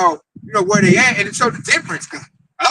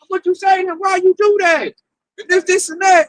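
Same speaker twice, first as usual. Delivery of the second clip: raised, incredulous coworkers; higher voice, demanding what he said; mock coworkers questioning; 8 semitones higher than usual. Coworkers crowding him, higher and questioning.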